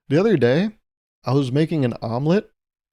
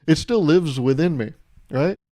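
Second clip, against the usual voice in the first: ordinary delivery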